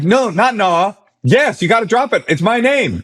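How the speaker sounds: high-pitched exasperated arguing; retold insistence. DJ telling the rival to drop the name, indignant insistence.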